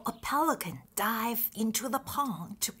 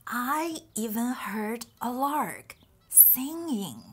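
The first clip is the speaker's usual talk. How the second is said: ordinary talk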